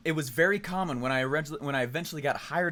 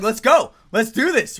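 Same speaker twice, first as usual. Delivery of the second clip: much louder, higher pep call; eager shout; 6 semitones higher, 11 dB louder, slower than usual. Retold or imitated. Urging the dodgeball players to start, shouting eagerly. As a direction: fire them up to get going. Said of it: "I was like".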